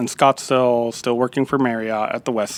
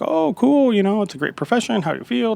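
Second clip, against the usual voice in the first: upbeat, much higher imitation of a friendly chef; 8 semitones higher than usual. Famous chef answering the young cook, higher and upbeat. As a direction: play the chef as cheerful and interested. Voicing chef's friendly reply.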